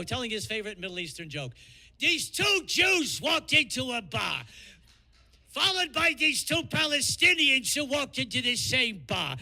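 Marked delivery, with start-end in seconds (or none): 2.0-9.4 Gilbert Gottfried. Pretend. Comic telling a Middle Eastern joke, Gottfried impression. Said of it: "Gilbert Gottfried, here we go".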